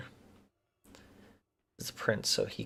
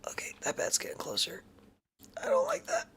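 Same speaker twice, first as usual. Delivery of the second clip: breathy, high, much softer, barely voiced; scared whisper; 7 semitones higher, 6 dB quieter than usual. Real, not acted. Gamer creeping past bats, scared whisper.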